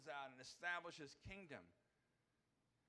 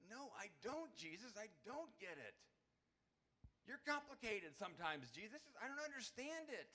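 higher, louder baffled protest to Jesus; confused protest; 7 semitones higher, 4 dB louder than usual. Pretend. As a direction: plead that you just don't get it. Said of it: "you know what I'd wanna say?".

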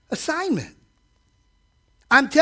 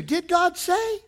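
higher, insinuating serpent voice; tempter's question; 8 semitones higher, slower than usual. Retold or imitated. Preacher voices the enemy tempting, insinuating. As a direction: sow doubt sweetly.

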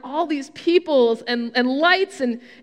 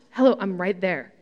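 mock-indignant inner remark, pitched down; incredulous aside; 7 semitones lower than usual. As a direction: drop low for a dry aside. Her unspoken reaction while being overlooked.

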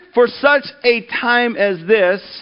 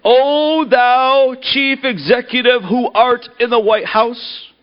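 put-on high, slow, grandiose old-style prayer; pompous prayer voice; 4 semitones higher, slower than usual. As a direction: intone it like a self-important preacher. Pompous tuxedo man prays.

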